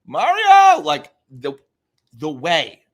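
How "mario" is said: much higher, loud yelled call; Luigi's cry for Mario. Retold or imitated. Imitating Luigi calling to his brother, loud and high. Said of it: "Luigi is saying".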